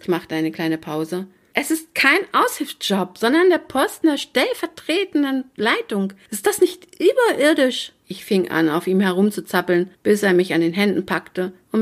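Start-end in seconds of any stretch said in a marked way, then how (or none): 1.5-7.9 high, gushing excitement; thrilled and squealy-high; excited news. A narrator's reading. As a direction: gush about the job, voice soaring. Bursting with news of interview.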